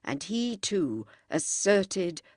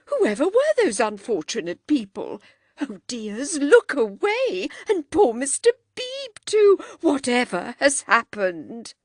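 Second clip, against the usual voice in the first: flustered alarm; 6 semitones higher, 6 dB louder than usual. A narrator's reading. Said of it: "cried Missus Honeychurch".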